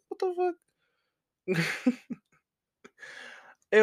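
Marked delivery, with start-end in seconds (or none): 0.1-1.6 way higher, a squeaky mock shock; stunned disbelief. After his friend hangs up, high incredulous squeak. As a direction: shriek it up in disbelief.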